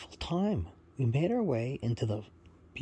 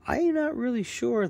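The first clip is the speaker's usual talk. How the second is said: middle brother; 12 semitones higher than usual. Acted. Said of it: "the middle brother says".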